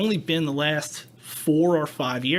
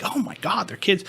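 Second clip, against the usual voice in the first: mock horrified coworker; 6 semitones higher than usual. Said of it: "Other people are like".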